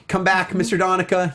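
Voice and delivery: ordinary talk, nothing marked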